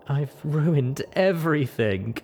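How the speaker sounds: slow, tearful lament; sobbing. Acted. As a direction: drag it out through tears. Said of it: "she sobbed into her hooves".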